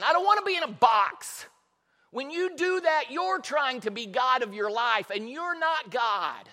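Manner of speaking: preacher voicing God's indignation; indignant God. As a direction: thunder an objection, then spell it out. Voices God protesting being boxed in.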